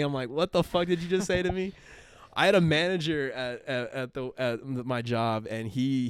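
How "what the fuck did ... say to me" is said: higher-pitched, incredulous retort; mock bafflement. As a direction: play up total confusion at the slang. Acted baffled reply to Boston slang.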